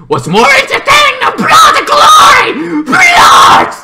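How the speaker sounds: a crazed ringmaster bellowing for blood; villainous roaring shout. Retold or imitated. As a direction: roar it like a bloodthirsty showman. Villain's speech in movie recap.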